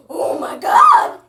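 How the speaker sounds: loud startled cry; surprised shout. Acted. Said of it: "the kid who is wondering".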